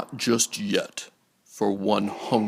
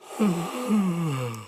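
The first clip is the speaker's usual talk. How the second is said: creature sigh; 9 semitones higher than usual. Acted. Sarlacc digests.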